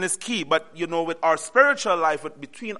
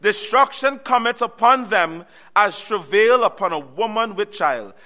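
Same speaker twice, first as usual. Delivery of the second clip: slow, raised verse reading; scripture reading; 3 semitones higher, 4 dB louder than usual. Acted. Preacher reading verse three aloud, slow and raised. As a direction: proclaim the verse slowly.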